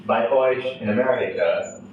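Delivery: put-on German verse recited with an American accent; poem reading. Translator reads Tucholsky's German satire to an audience, measured poem reading. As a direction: recite in measured phrases, pausing between lines. Resumes the poem after a stumble.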